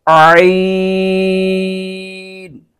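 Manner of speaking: आइन drawn out slowly from the middle of the throat. Shown as a demonstration. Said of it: "ये हलक के दरमियान से ये पढ़ा जाएगा". (आइन हलक के दरमियान से धीरे खींचकर)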